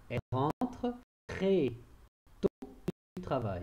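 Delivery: slow, word-by-word dictation pace. Shown as a demonstration. Teacher dictating a sentence to pupils, slow and deliberate. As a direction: dictate each word apart, slowly. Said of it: "Écris les mots avec l'accent qui convient".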